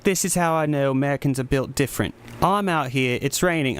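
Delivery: a light put-on British accent. Streamer quoting a British guy about the rain, light British accent. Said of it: "I'm gonna stop with the accent".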